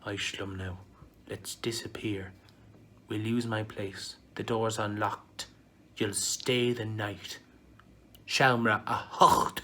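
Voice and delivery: soft breathy whisper in his ear; seductive whisper. Acted. City Sue whispers to Micheál, soft seductive whisper. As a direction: lure him in a hushed voice. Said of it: "she whispered into Micheál's ear".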